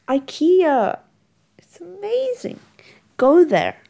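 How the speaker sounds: squealing with delight at ikea; excited gushing. Real, not acted. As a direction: rave about it with a big lift.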